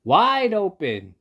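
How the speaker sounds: slow, high, loud yell; yelled order. Acted. Wife yelling at the fox to open wide, slow high yell. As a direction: bellow it out slowly. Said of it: "yelled his wife".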